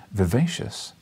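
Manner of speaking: usual speaking voice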